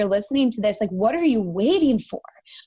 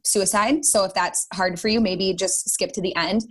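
ordinary talk, nothing marked

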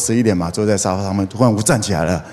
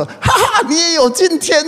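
much higher mocking laugh-line; gloating tease. Bassist teasing the new father-to-be, gloating. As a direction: rub it in like an old friend. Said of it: "贝斯手钟林讲说".

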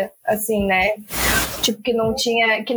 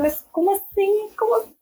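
shocked disbelief; 8 semitones higher than usual. Retold or imitated. She recalls her date's bizarre request, shocked high disbelief. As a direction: pitch jumps way up in disbelief. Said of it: "Eu falei".

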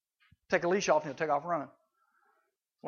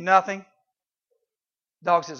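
usual speaking voice

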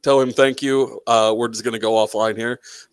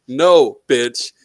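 ordinary delivery

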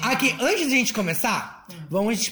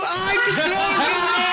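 high, drawn-out wail of dismay; 7 semitones higher, much slower than usual. Real, not acted. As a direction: wail it out, half laughing. Dismay at drawing gaúcho task.

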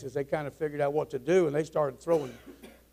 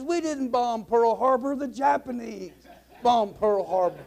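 higher-pitched, drawn-out mock objection; acted protest; 6 semitones higher than usual. Chinese co-pilot answers the pilot's accusation, protesting. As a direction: object to a false charge.

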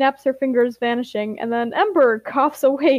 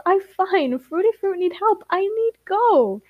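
put-on higher-pitched little-kid voice; small stubborn child, higher in pitch; childlike insistence; 7 semitones higher than usual. A narrator's reading. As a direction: insist in simple, broken words. Ash's childlike broken speech.

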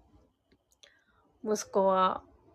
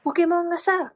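higher-pitched mimicry of standard Japanese; standard Japanese imitation; 8 semitones higher than usual. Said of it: "標準語に近いよね". (標準語で説明する子どもの高めの物まね)

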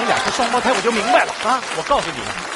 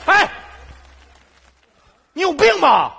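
angry yell; 9 semitones higher than usual. Acted. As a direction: yell it at the pest. Stranger yelling over thrown bag.

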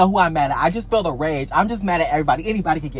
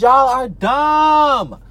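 slow scornful jab; 6 semitones higher, 3 dB louder, much slower than usual. Real, not acted. Ranting at other creators.

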